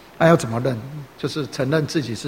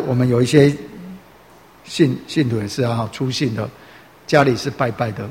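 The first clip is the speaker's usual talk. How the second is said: usual speaking voice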